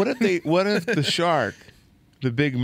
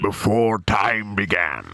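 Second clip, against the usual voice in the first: deep, slow epic intro; hammed-up portentous narration; mock trailer narrator; 5 semitones lower, slower than usual. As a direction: intone it like a film prologue. Echoes the mock movie prologue.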